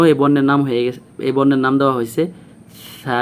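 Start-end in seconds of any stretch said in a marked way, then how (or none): 3.0-3.2 "সা" as a gentle lisped Arabic tha; tongue tip to front teeth, release "সা" softly; long "সা" with a thin, soft consonant; soft, thin pronunciation. Shown as a demonstration. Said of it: "মানে একটু মৃদু হবে".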